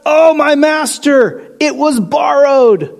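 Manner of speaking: a touch of anguish, drawn out; mild cry of dismay. A narrator's reading. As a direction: read it as a man crying out over a lost tool. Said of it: "he cried out".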